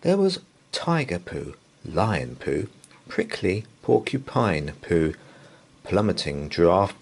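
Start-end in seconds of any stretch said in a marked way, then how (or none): none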